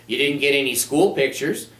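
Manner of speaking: ordinary talk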